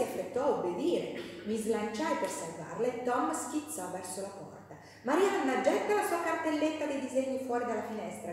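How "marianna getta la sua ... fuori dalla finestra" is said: loud, higher call to his sister; a naughty boy's raised call; boy shouting. Acted. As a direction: yell the order like a mischievous boy. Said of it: "gridò il ragazzo".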